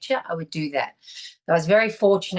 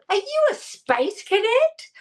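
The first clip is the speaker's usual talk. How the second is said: incredulous thought; 13 semitones higher, slower than usual. Retold or imitated. Thinking about the odd stranger.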